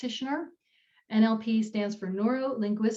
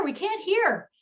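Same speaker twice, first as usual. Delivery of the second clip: "hear" falling, much higher, called out insistently; mock audience call; 10 semitones higher, slower than usual. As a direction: repeat the call, letting "hear" drop firmly. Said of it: "The people in the front rows call out".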